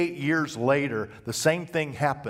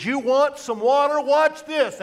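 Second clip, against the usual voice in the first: loud, high, mocking taunt at the crowd; angry Moses; 13 semitones higher, 7 dB louder than usual. Moses taunting the griping people, loud and angry. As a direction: hurl it at them like a furious prophet.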